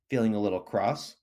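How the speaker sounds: ordinary delivery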